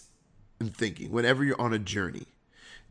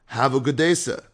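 raised, upbeat greeting in an imitated Caribbean accent; imitated Caribbean-sounding accent; 5 dB louder than usual. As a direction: greet travelers cheerily, mimicking a Caribbean lilt. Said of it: "when I do this accent".

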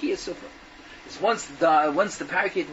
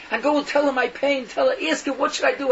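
urgent plea; 6 semitones higher, 4 dB louder than usual. Acted. Caged bird pleads for sister's help.

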